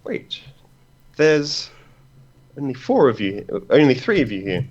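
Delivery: a lightly voiced stern ruler; high-pitched queen voice. Ruler voiced counting guests.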